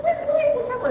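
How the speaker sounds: retold protest. Voiced daughter objecting to mother. (protesto imitado)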